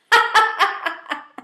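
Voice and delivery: high, delighted cackle; gleeful laugh. Real, not acted. Toasting the departed player, gleeful laugh. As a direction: laugh with glee at his exit.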